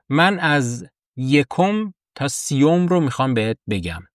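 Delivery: ordinary talk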